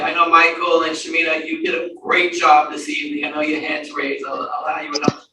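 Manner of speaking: normal talking voice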